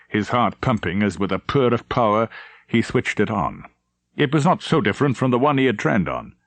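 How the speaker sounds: ordinary delivery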